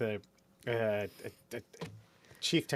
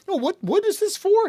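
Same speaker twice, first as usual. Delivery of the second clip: high, louder dopey mimicry; mock clueless questioner; 6 dB louder than usual. Client who skipped the email asks, mock clueless. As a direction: imitate a baffled email sender, high-pitched.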